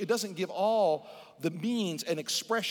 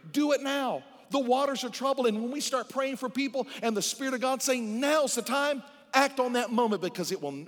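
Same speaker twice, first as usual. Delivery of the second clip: raised, pressing pulpit voice; preaching at full force; 5 semitones higher than usual. Acted. Pastor pleading with the church, raised and urgent. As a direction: urge the congregation to move now.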